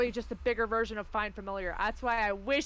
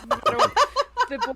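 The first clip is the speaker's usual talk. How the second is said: laughing; 10 dB louder than usual. Real, not acted. Group joke about Canadian boar.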